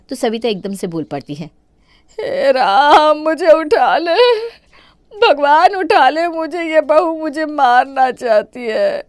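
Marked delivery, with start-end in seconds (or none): 2.2-9.0 loud, slow theatrical crying; fake wailing. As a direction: wail to win your son's pity. Mother-in-law fakes distress to son. (बेटे के सामने नाटकीय रोना-धोना)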